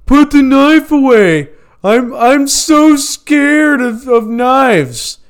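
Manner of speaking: high, squeaky, panicky coworker; mocking squealer voice. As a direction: squeal it out like a scared man. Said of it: "he's like".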